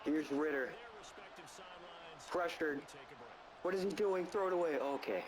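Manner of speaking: running sportscaster commentary; play-by-play call. Acted. Gamer calling the final drive, play-by-play. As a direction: narrate each snap like a broadcast. Plays announcer over Madden game.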